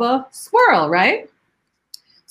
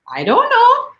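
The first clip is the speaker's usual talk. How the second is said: put-on high, rising little-kid answer; confused child; 12 semitones higher, 4 dB louder than usual. The puzzled child answers the teacher, in a high voice. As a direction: pitch it up like a stumped schoolchild.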